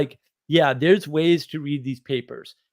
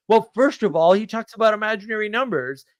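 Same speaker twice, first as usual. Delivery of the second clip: indignant buddy; 8 semitones higher than usual. Retold or imitated. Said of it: "He says".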